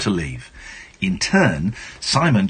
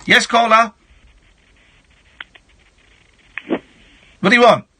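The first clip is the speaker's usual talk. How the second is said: high, raised demand to a caller; brusque call-taking; 13 semitones higher, 5 dB louder than usual. Pretend. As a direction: demand it abruptly, pitched way up. Brusquely answering a phone caller.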